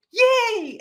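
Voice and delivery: high, loud drawn-out cheer; excited cheer. Retold or imitated. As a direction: squeal it with glee, long and high. Retold delight at seeing actress.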